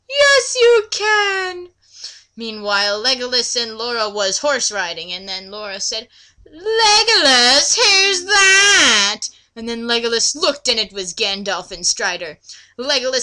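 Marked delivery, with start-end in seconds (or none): 0.1-1.7 high, very slow villain voice; Dark Lord voice. Acted. Dark Lord granting the orc boss his wish, high and slow. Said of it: "I'm going ever with the voices here". 6.5-9.2 put-on high, slow, loud child voice; little girl voice. Laura asking Legolas about the riders, high and slow. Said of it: "I'm going ever with the voices here".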